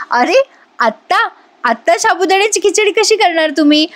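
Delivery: amused disbelief; laughing. Acted. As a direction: ask it while laughing along. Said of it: "Nandini पण हसते आणि म्हणते".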